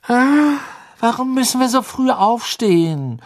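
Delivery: high, loud yawning complaint; tired, high-pitched whine; sleepy whining. A narrator's reading. Sleepy girl yawns and complains.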